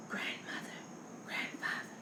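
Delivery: hushed, voiceless whisper. A narrator's reading. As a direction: whisper softly to sleeping grandparents. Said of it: "Anna Hibiscus whispers".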